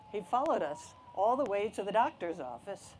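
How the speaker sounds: plain reading voice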